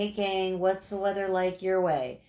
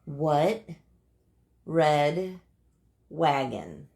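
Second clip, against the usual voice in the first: one word at a time, long pauses between; very slow tongue twister; 3 semitones lower, much slower than usual. Shown as a demonstration. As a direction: spell out each word of the tongue twister slowly. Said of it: "I have to say it really slow".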